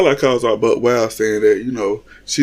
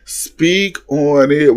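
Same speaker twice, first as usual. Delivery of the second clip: slow, drawn-out mock hype-woman voice; egging on; 3 semitones higher, much slower than usual. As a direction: stretch it out like cheering a friend on. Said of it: "New York the whole time talking about".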